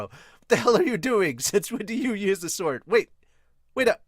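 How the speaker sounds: a hammed-up annoyed scold; mock angry Zoro; Zoro yelling.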